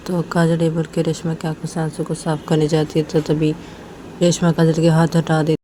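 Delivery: ordinary delivery, nothing marked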